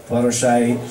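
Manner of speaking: ordinary delivery, nothing marked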